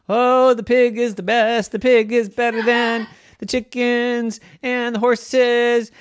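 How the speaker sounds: high, loud bragging pig; little pig singing his own praises; boastful piglet. Acted. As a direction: crow about yourself like a smug little pig.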